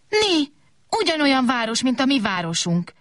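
high, delighted cry of recognition; little chair calling out in joy; high, bright, excited little chair; excited exclaim. A narrator's reading. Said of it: "kiáltott fel a kicsi szék".

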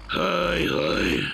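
high, sighing haj haj. A narrator's reading. Gombóc Artúr sighs after the truck leaves. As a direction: sigh it out sadly. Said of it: "sóhajtott Gombóc Artúr".

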